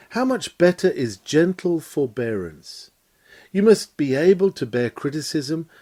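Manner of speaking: ordinary talk, nothing marked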